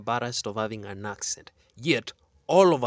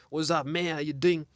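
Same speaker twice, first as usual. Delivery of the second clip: mock Western accent, a little higher; 3 semitones higher than usual. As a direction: imitate a returnee's put-on Western accent. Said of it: "you go to Western countries".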